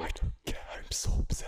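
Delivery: hushed mock upset whisper; mock sulky whisper. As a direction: whisper your hurt into the mic. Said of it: "You're gonna be whispering in one ear".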